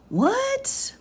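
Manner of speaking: sudden high-pitched incredulous what; shocked what. Real, not acted. Reader baffled by a bad legal citation, shocked high squeak. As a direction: blurt it out in disbelief.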